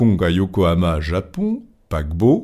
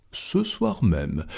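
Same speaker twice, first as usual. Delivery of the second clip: soft, slow, settled answer; calm confirmation; 6 dB quieter, much slower than usual. Acted. Fogg confirms his departure to Stuart, calm and quiet.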